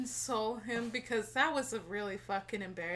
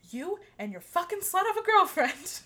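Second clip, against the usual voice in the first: imitating an angry boy, louder and higher; mock angry insult; 8 semitones higher, 8 dB louder than usual. Imitating the ex's friend snapping at her. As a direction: spit out the insult in a hammed-up rage.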